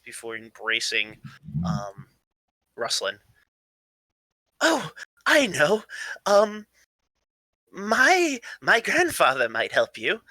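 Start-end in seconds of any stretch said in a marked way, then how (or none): 4.6-10.2 high, swooping, eager character voice; scatterbrained and eager, pitch leaping about; excitable eccentric grandson. Acted. Plays an excitable odd character.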